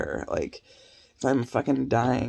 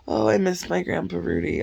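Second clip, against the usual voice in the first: lightly mimicked kid, higher pitched; higher, mock-wistful imitation; mock child's lament; 6 semitones higher, 4 dB louder than usual. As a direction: put on a higher voice to mimic a kid missing someone. Mimicking kids missing their grandpa.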